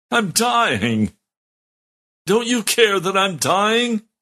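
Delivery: high, slow, pleading cry that breaks upward; retold anguished cry. Pastor in the bathtub crying to God, high anguished plea. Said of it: "I began screaming at God".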